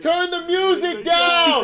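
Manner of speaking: slow, loud, high shout over music. Retold or imitated. To grandpa in the car, slow loud shout. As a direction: shout it slowly over the noise. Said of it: "I said".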